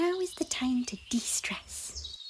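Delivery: slow, then a whispered de-stress line; a mock-serious relaxation coach; playful calming. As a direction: coax them to relax, slowing right down. Izzy playfully leads a relaxation.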